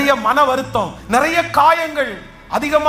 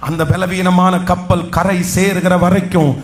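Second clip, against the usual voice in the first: fervent preaching; 2 semitones lower than usual. Acted. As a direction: build the church up with bold conviction. Preaching at full force.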